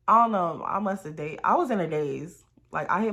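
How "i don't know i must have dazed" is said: ordinary talk, nothing marked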